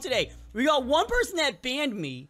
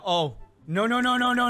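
ordinary talk

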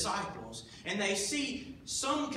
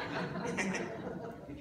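quiet breathy chuckle; real soft laugh; 5 dB quieter than usual. Real, not acted. Preacher chuckles to the congregation at his own joke.

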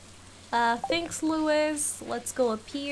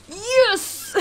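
loud, high cry of triumph; excited yes; 10 semitones higher, 7 dB louder than usual. Real, not acted. Gamer finding clay at last, a loud triumphant yes. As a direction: whoop like you've finally won.

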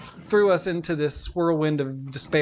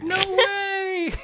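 very high, squealed surprise; delighted disbelief; 14 semitones higher than usual. Real, not acted. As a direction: react amazed, voice way up high. Reacts to two new puppies.

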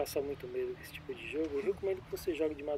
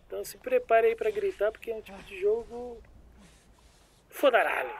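exaggerated hype; 7 semitones higher, 9 dB louder than usual. Hyped warning to viewers.

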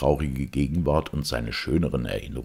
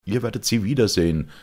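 Georg, higher, louder and quick; young knight's rousing promise; 7 semitones higher, 5 dB louder, faster than usual. Acted. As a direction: take up the words with fervour.

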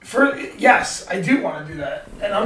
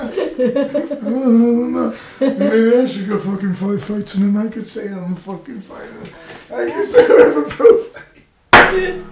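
Ray Longo impression; slower than usual. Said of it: "Ray, you wanna jump in there?".

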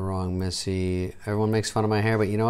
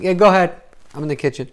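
raised, higher voice called from another room; calling out; 7 semitones higher, 6 dB louder, much faster than usual. Acted. Comic wanders off during a paid call, calling out. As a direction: call back as if from the kitchen.

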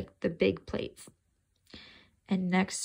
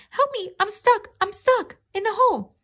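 much higher, much louder shriek of panic; panicked cry for help; 12 semitones higher, 6 dB louder than usual. A narrator's reading. Toby trapped in the fence, shrieking panicked for help. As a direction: scream for help as the dog closes in. Cat trapped, dog approaching.

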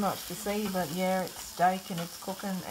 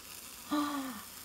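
sharp gasp of shock; surprised gasp; 6 semitones higher, 5 dB quieter than usual. Pretend. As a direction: gasp as if you've seen something wild. Reveals the steak, mock shock.

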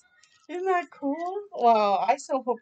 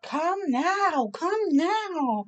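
Mama calling; 6 semitones higher, much slower than usual. Acted. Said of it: "there's the mummy shouting".

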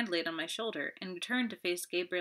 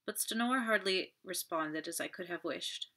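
ordinary delivery, nothing marked

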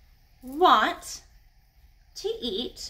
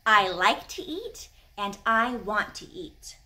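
slow teaching; 5 semitones lower, slower than usual. Shown as a demonstration. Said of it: "now I'm gonna teach you".

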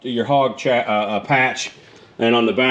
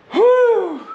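a high, loud puff of breath; winded whew; 4 dB louder than usual. Real, not acted. Vlogger out of breath after jacking the bike, a winded whew. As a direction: blow it out, really winded. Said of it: "fat man's winded".

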